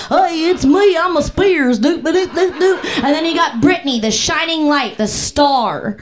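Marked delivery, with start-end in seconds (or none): 0.1-2.8 hammed-up podunk family member; podunk relative. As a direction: play a small-town nobody singing doop-doop. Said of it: "Just some podunk fucking".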